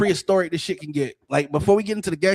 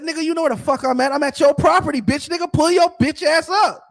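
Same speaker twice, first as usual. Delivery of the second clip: higher, louder, fast heated challenge; retold angry yell; 9 semitones higher, 5 dB louder than usual. Telling the neighbor on the phone where he is, heated yelling. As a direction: spit it at him like a dare. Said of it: "I said".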